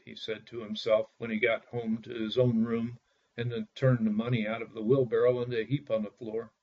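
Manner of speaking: ordinary delivery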